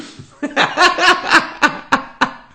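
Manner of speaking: high, loud cackle of a laugh; real belly laugh; big laugh. Real, not acted. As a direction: burst out laughing. Laughing at own joke.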